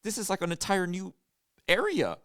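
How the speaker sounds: high, loud disbelief; animated, awestruck retelling; imitated amazement. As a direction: marvel at a huge space, mimicking a baffled friend. Imitates companion marveling at the space.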